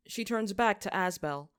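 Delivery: ordinary delivery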